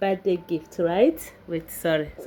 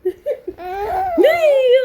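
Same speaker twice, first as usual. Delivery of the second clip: high excited yay; squealing happy yay, much higher; excited cheer; 5 dB louder than usual. Real, not acted. Cheering, coaxing son.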